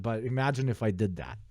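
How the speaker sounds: ordinary delivery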